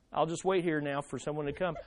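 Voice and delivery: low, quiet, quick aside; casual, unbothered murmur; mock nonchalance. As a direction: settle back as if nothing is urgent. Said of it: "you don't just say".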